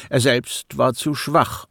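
ordinary delivery, nothing marked

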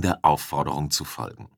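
usual speaking voice

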